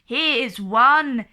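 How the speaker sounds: loud challenging shout. A narrator's reading. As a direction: shout it out from hiding. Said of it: "shouted Norman".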